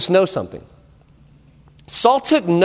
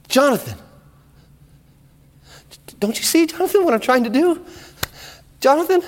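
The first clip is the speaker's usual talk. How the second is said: higher, coaxing then sinking to a menacing hush; a father's pleading manipulation fading to a murmur; wheedling plea; 6 semitones higher than usual. Acted. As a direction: coax your son, then darken toward the killing. Saul pleads, urging murder.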